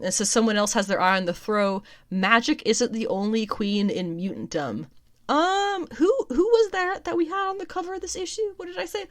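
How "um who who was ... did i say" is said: high-pitched mock wondering; feigned innocence. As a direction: play dumb in a much higher voice. Feigns forgetting the cover villain.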